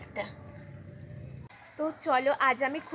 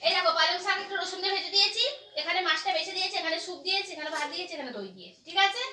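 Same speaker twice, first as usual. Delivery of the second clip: coaxing; 4 semitones higher, much faster than usual. Real, not acted. Coaxing her mother to eat.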